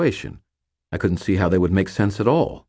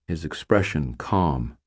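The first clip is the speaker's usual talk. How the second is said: ordinary delivery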